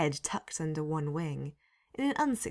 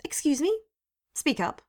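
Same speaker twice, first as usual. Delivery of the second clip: higher, louder stern governess; stern, louder demand to speak up; 10 semitones higher, 6 dB louder than usual. A narrator's reading. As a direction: snap it like a strict teacher. Susan demands Jack speak up.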